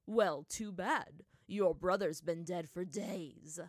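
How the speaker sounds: slow, loud, spiteful jeer; venomous taunt. A narrator's reading. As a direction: drag it out to wound the listener. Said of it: "Bato said venomously".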